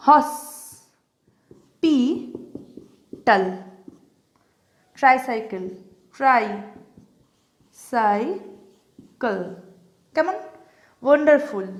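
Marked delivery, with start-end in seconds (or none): 0.1-3.4 "hospital" very slowly, long pauses between three syllables. Shown as a demonstration. Teacher breaking up hospital for students, syllable by syllable. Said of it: "হসপিটালটাকে ভাঙি". 6.2-9.4 "tricycle" very slowly, long pauses between three syllables. Shown as a demonstration. Teacher showing how tricycle breaks up, syllable by syllable. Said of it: "আমি ভেঙে দেখাই".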